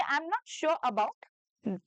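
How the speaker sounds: slow Indian English, "sure" with a faint r. Shown as a demonstration. A teacher modelling the Indian English version. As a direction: clear Indian English accent, unhurried. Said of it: "Indian की तरह ही बोलना है".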